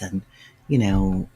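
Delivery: usual speaking voice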